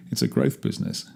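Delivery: breathy laugh through the joke. Real, not acted. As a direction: chuckle at your own dark joke. Joke about dying trade.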